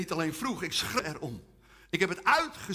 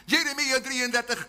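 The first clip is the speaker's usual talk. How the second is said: ordinary delivery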